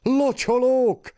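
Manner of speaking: high, slow cry of alarm; shout. Acted. Woman alerting the neighbours, crying out high and slow. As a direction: call the whole house out. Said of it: "A kiáltásra".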